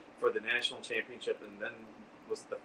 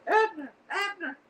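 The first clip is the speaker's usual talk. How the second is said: shrill high shout of Abner, much higher; Gladys Kravitz calling Abner; 4 dB louder than usual. Retold or imitated. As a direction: yell Abner like a nosy neighbour calling her husband. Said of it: "Do you remember Gladys Kravitz?".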